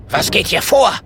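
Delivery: high, sharp angry wizard demanding an answer; shrill scolding. A narrator's reading. The wizard scolds the friends in the dungeon, high and angry. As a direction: snap at the prisoners. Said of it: "keift Ziegenbart".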